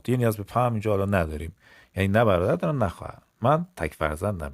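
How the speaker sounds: ordinary talk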